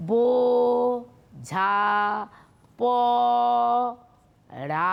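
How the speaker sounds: "বো, ঝা, প, রা" split into four slow syllables with long pauses. Shown as a demonstration. Teacher to young students, showing the breath breaks, syllable by syllable. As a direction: break বোঝা পড়া into বো, ঝা, প, রা, pausing after each. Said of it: "লিখে লিখে পড়াচ্ছি".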